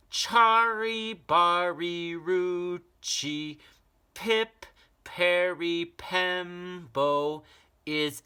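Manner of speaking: put-on high, slow, careful child's voice; very slowly. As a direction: spell out every syllable with care. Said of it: "very slowly said".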